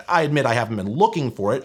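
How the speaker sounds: usual speaking voice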